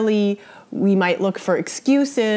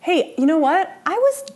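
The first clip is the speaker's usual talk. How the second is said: higher, indignant excuse-making; defensive; 6 semitones higher, faster than usual. Shown as a demonstration. Teacher acting out a defensive partner's reply, higher and indignant. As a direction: push back to make them back off. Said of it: "the defensive response could be".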